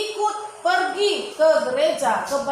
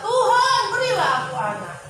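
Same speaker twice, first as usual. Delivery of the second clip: Tuhan called out high and loud, then the plea drops fast; a mother's desperate cry to the Lord, then a rushed plea; Hana cries out in prayer for a child; crying out to God; 4 semitones higher, 3 dB louder than usual. Acted. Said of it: "dan dia berkata".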